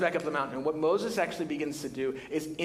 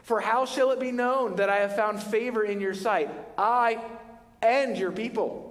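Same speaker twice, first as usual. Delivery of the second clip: higher, intense pleading prayer; Moses pleading; 5 semitones higher than usual. Retold or imitated. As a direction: beg God to stay with his people. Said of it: "he said to him".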